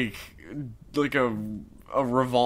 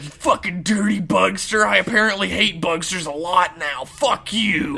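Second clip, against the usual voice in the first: hammed-up angry yelling, higher and louder; Nico; 6 semitones higher, 4 dB louder than usual. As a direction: rant in mock fury. Acted tirade of Nico attacking.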